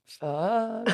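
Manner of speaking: word forced out on a burp. Real, not acted. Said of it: "She's got a burp".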